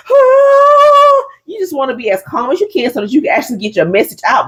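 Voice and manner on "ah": high loud panicked cry; mock panic shriek; panicked wail. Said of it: "you don't wanna be like".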